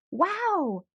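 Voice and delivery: put-on high, bright-eyed child's wow; a kid amazed by the piñata; excited wow. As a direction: gasp it out in wonder. Child sees giant piñata.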